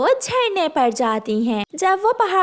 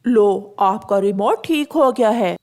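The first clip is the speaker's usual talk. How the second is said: lower, lightly voiced shopkeeper; pigeon shopkeeper; 4 semitones lower than usual. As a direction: hand it back kindly. Pigeon returns remote.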